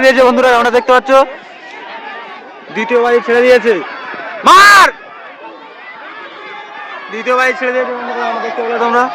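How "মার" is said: sudden loud high shout. Real, not acted. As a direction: yell it out as the race starts. Race start, calling out.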